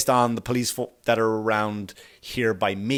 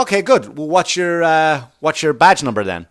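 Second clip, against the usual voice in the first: defiant challenge; 6 semitones higher, 7 dB louder than usual. Retold or imitated. Retorting to the cop.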